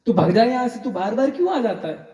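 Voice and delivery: exasperated shooing. Acted. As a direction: chase him off with a laugh. Baba chasing the boy off again.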